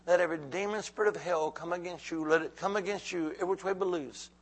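ordinary talk

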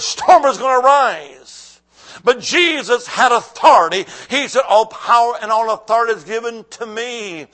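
loud, driving sermon delivery; full-force preaching. Real, not acted. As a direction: preach it hard to a full church.

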